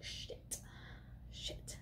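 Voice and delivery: very soft whispered curse; dismayed whisper. Retold or imitated. Maya sees the bag taken, whispered mock dismay. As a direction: whisper it as if caught out.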